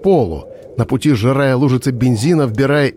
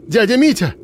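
high startled cry; shocked cry; 9 semitones higher than usual. A narrator's reading. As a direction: call out in disbelief.

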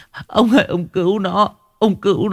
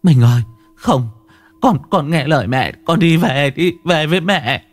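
higher-pitched, urgent call to a son; desperate pleading; 6 semitones higher than usual. Acted. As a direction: plead with him to come back. Mother calls vanishing son in dream. (van nài con khẩn thiết)

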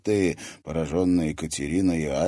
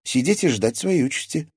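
scornful, raised-pitch retort; mocking; 7 semitones higher, 4 dB louder than usual. A narrator's reading. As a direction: scoff at the question. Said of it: "насмешливо фыркнула".

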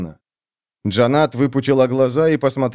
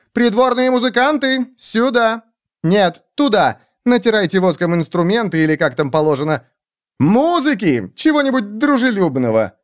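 calling out; 7 semitones higher than usual. Acted. King calls out to musicians.

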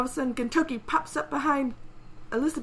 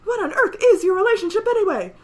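louder, higher commentator voice; announcer; 7 semitones higher, 7 dB louder than usual. Acted. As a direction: call a shocking turn in the contest. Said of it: "And the announcer".

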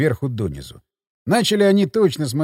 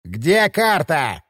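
slow, insistent thug voice; stubborn repeating; much slower than usual. A narrator's reading. Second burglar repeats his demand, slow and insistent. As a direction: drag it out, he's asked before. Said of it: "заладил второй".

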